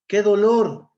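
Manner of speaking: loud, high exclamatory cry; exclamation. Shown as a demonstration. Teacher gives class an example of exclamation marks, loud exclamation. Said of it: "expresan sentimientos de alegría, de tristeza, admiración".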